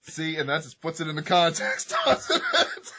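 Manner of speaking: speaking through high laughter; laughing. Real, not acted. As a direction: laugh the words out. Laughing at the joke.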